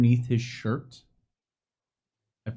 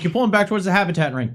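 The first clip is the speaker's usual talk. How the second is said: rushed, louder quote; fast quote; 8 semitones higher, 7 dB louder, much faster than usual. Retold or imitated. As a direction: rattle it off quickly. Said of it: "Because I was like".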